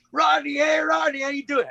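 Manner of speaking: high, loud shout across traffic; excited fan shouting his name; calling out from a van. Retold or imitated. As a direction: yell a friendly hello from the next lane. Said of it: "the guy looks over and goes".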